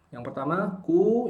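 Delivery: normal talking voice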